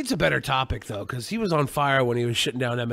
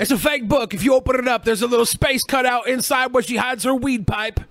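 loud, high, mock-insistent claim; mock insistent shout; 9 semitones higher, 6 dB louder than usual. Friend loudly insisting the book is fake, mock shouting. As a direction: shout it like you're winning the argument.

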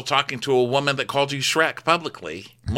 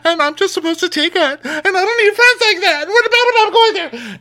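very high, louder, building to a wail; mock whiny Jen; 6 dB louder than usual. Co-host imitating Jen complaining about Heather, rising whine. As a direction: whine it higher and higher. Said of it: "clip of Jen saying".

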